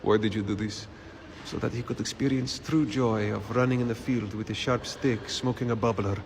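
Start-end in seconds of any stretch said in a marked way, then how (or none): none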